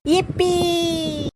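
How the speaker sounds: high, drawn-out yippee; a child's squeal of joy, slowly stretched; excited cheer. Acted. (बच्चे की खुशी भरी ऊँची चीख)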